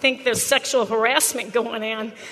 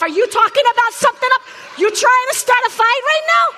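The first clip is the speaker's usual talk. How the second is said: mock confrontation; 9 semitones higher, 4 dB louder, faster than usual. Teacher confronts laughing students.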